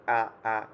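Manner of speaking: a firm, clipped glottal আ; hard alif sound. Shown as a demonstration. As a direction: hit আ hard with a catch in the throat. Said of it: "একটু hard করে, উচ্চারণটা করতে হবে".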